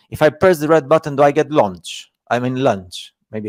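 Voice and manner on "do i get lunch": ordinary delivery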